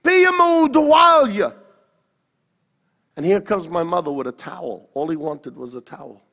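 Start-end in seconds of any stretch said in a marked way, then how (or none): 0.0-1.6 high, loud shouted call in Italian; retold father's yell. Father yelling for the mother to bring a towel, loud. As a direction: bellow it across the house like an impatient dad. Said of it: "He yells out".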